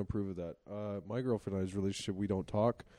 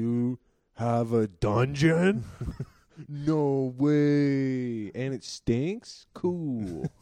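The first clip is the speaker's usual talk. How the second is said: slow, loud, drawn-out awe; hammed-up delight, stretching every word; mock amazement; 4 semitones higher, 7 dB louder, much slower than usual. Imagined date hearing about a fart dungeon, mock amazement.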